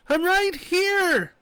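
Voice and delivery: high, loud, slow shout. A narrator's reading. Woman shouting to be noticed from the hole. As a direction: stretch it out so they hear. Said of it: "she shouted".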